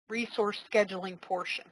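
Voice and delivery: ordinary talk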